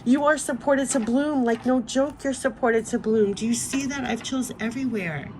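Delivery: overcome, words straining at the top of the voice; moved, voice breaking. Real, not acted. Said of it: "my voice is giving out".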